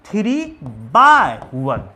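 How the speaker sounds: teacher models the reading slowly; slow model answer. Shown as a demonstration. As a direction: say three by one slowly and clearly as the model answer. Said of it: "इसको कैसे पढ़ेंगे?".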